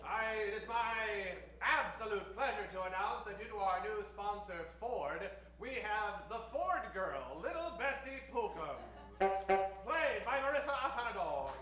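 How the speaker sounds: ordinary talk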